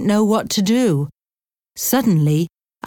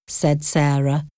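ordinary delivery

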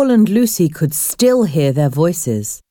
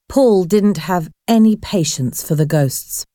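plain reading voice

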